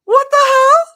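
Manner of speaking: high, loud outburst; real startled shout; shocked yell. Real, not acted. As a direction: yelp it in disbelief.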